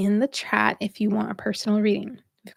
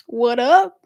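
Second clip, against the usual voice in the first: mock hype greeting; 2 semitones higher, 6 dB louder than usual. Voicing a defiant spirited self.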